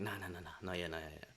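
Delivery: hushed self-talk; telling himself to wait, low and hushed; inner voice. Retold or imitated. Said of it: "It's like".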